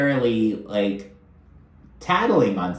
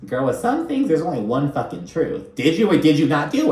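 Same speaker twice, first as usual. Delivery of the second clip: high-pitched exasperated insistence on some; pushing hard that there's only one truth; emphatic; 5 semitones higher than usual. Real, not acted. Recapping the reality show to viewers, exasperated and emphatic. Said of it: "emphatic insistence".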